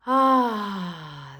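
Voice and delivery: loud, open "ah" sigh; releasing sigh. Shown as a demonstration. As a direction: let it all go in one big exhale. Releasing breath during guided untethering.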